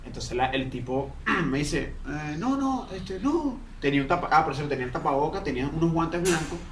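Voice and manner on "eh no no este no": hint of a thin, high voice; mock delicate voice of the man; high-pitched man's voice. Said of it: "su voz era un poco así como".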